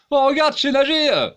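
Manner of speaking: put-on high, loud childish crowing; mock excited boast. Mock swimmer shouting proudly to the viewer. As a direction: crow like a proud kid who can swim.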